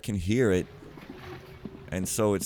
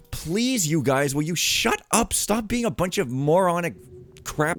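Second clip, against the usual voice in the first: high, loud burst of exasperation; exasperated shout; 9 semitones higher, 4 dB louder than usual. Real, not acted. Player yelling at game characters in the way, exasperated. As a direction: plead loudly, fed up with them.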